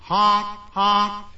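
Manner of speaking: high, clipped horn honks; car horn honk. Acted. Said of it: "he heard another noise".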